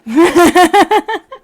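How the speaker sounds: big laugh, high and loud; loud burst of laughter. Real, not acted. Laughing at her own joke to viewers, loud burst of laughter.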